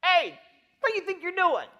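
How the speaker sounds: high, loud scolding challenge; sharp rebuke. Acted. Holy Ghost acted rebuking a sinner.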